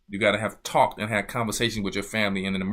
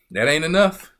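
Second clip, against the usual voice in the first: louder, ENOUGH pushed up at the end; loud rising emphasis; 4 semitones higher, 6 dB louder than usual. Acted. Preacher drives the point home to the congregation, loud and rising. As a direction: hit "That ain't enough" hard and loud, rising on "enough".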